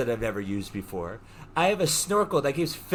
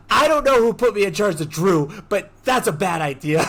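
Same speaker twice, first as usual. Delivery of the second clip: loud, raised-voice complaint; retold panicked protest; 4 semitones higher, 6 dB louder than usual. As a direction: protest loudly, easing slightly at the end.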